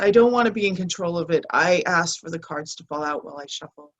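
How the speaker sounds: usual speaking voice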